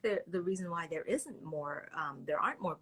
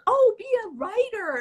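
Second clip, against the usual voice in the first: high, loud mock mom gushing; hammed-up mother urging her daughter; 14 semitones higher, 10 dB louder than usual. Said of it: "And my mom's like".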